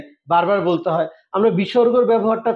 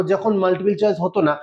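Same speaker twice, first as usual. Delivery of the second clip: ordinary talk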